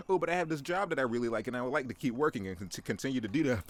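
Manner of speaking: breathless mock pleading; whiny victim. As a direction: rush the complaint like a desperate worker. Whiny victim answers the Jersey man.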